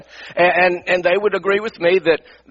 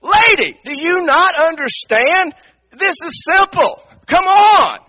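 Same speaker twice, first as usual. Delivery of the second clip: higher and louder, fed-up and slow on do you not understand; impatient exasperation; 11 semitones higher, 4 dB louder, slower than usual. Retold or imitated. Waiting in line, pastor fumes at the dawdling old lady. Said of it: "you're sitting there thinking".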